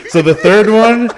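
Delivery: talking through leftover laughter; laughing. Real, not acted. Laughing while starting next story.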